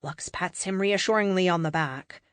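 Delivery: usual speaking voice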